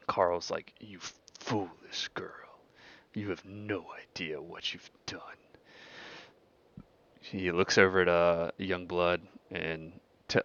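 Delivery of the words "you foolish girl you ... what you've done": slow, half-whispered contempt; quietly ominous villain; menacing pack leader. Acted. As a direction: rebuke the upstart in a breathy, drawn-out threat. Carl menacingly rebukes his challenger.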